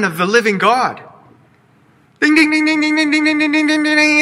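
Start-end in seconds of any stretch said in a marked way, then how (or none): 2.2-4.2 high, rapid ding ding ding like a quiz bell; game-show bell. Pretend. Preacher cheers Peter's answer with a high bell sound. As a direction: ring out the winning-answer chime.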